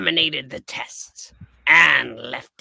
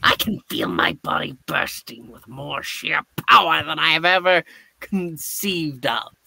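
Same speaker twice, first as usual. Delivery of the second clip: normal talking voice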